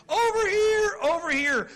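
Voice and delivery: high, loud shout; shouting for attention. Pretend. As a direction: yell it to get everyone's eyes. God's star calling for attention.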